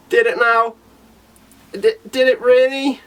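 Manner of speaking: high, sceptical questioning; mock disbelief.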